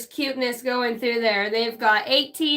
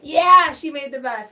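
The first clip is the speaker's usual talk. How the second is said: mock cheer; 3 semitones higher, 8 dB louder than usual. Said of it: "I'm like".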